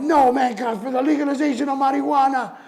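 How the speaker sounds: high, loud protest; rally for the cause as they drag you; defiant outcry. Retold or imitated. Rapper loudly protesting while being dragged out.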